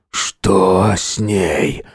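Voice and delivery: very slow, strained demand; angry, laboured. A narrator's reading. Wounded father repeats question, angry and laboured. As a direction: force each word out heavily. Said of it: "Он гневно посмотрел на меня".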